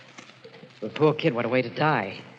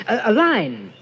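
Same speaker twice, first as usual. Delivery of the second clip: rising agitation; 9 semitones higher, 6 dB louder than usual. Acted. Frank grows agitated.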